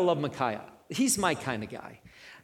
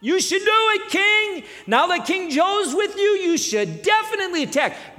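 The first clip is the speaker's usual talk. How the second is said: high, loud fawning flatterers; hammed-up flattering courtiers urging the king on; mocking yes-men; 6 dB louder than usual. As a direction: gush agreement like eager yes-men. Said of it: "the four hundred men that says".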